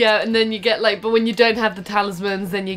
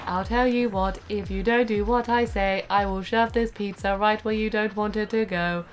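softer, even robot threat; robot Rosie; 7 dB quieter than usual. Retold or imitated. Rosie robot threatening a customer, monotone robot voice. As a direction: threaten without any change of note. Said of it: "she'd be like".

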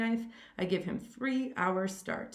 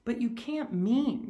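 slow, higher and louder disbelief; shocked gasp; 5 semitones higher, 5 dB louder, slower than usual. A narrator's reading. Rainsford grasps what the general hunts, shocked gasp. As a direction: draw it out, aghast at the idea. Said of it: "gasped Rainsford".

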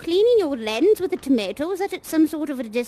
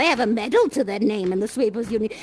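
ordinary delivery

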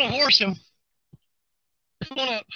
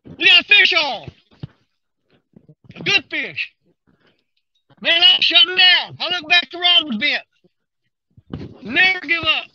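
higher, raised excited voice; thrilled, calling out the surprise catch; 5 semitones higher than usual. Real, not acted. Fish bites while he is packing up.

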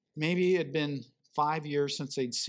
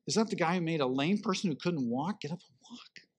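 hushed whispering; 4 dB quieter than usual. Retold or imitated. Lepers whispering among themselves.

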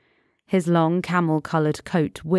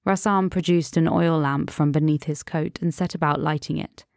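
usual speaking voice

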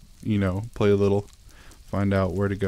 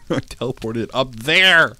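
squeaky laugh into a loud disbelieving cry; laugh, then shout the discovery; surprised laugh; 4 semitones higher, 6 dB louder, slower than usual. Real, not acted. Baffled by the game teleporting him, loud laughing surprise.

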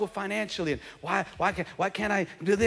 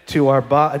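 mock rote grace; 5 semitones lower, 8 dB louder than usual. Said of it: "This double cheeseburger".

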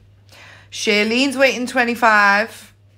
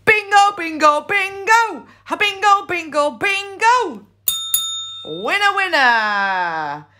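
excited bingo call; 6 semitones higher than usual. Real, not acted. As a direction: chant bingo in delight. Announcing a bingo win.

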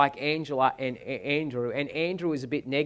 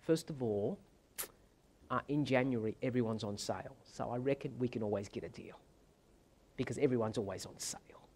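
meek hesitant plea; 4 semitones lower, 7 dB quieter than usual. Retold or imitated. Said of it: "in my typical way".

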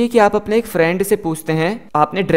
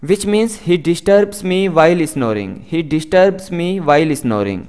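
very slow, careful English for learners; slow model sentence; much slower than usual. Shown as a demonstration. Teacher reading the example slowly to students. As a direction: spell each word out slowly for the class. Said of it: "teacher model sentence".

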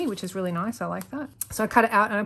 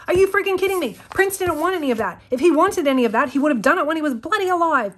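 higher, louder indignant rant; real outrage; 8 semitones higher, 5 dB louder than usual. Real, not acted. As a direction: vent the fury at her, pitched up and loud. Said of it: "really passionate about this".

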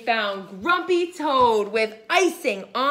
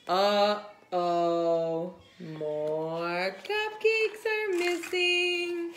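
plain reading voice

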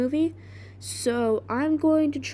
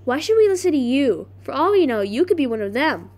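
loud, challenging retort, settling at the end; acted distrustful challenge; Nancy; 4 dB louder than usual. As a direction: throw the accusation out forcefully.

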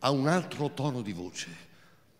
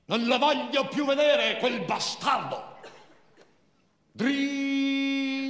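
higher, louder, furious; Marina's thin voice; 10 semitones higher, 4 dB louder, much slower than usual. Acted. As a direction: spit the insult high and thin. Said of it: "quella sua voce sottile e inadeguata". (voce sottile, furiosa)

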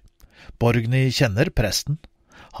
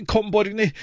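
mother calling her daughter urgently; 7 semitones higher than usual. Acted. Mother calls Borgny away from the spark rain, urgent. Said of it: "roper mor".

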